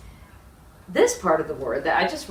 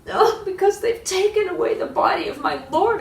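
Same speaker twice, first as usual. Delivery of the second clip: high, louder grieving voice; Mary's distressed plea; 13 semitones higher, 6 dB louder than usual. Acted. Mary pleading with the gardener, high and grieving. Mary tells the stranger her grief.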